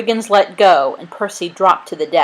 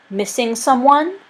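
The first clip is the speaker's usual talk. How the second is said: high, lilting mock question; teasing taunt; 6 semitones higher than usual. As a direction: taunt sweetly, pitch climbing. Taunting with the captured dog.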